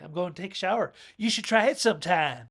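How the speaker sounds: put-on sassy woman needling her boyfriend. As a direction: toss it off with attitude. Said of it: "that's the kinda sassy talk".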